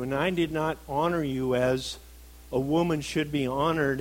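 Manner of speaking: emphatic, heartfelt apology; earnest confession. Retold or imitated. Husband confessing to his wife, earnest plea for forgiveness. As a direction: confess each fault firmly, then plead. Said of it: "But when I go to her and say".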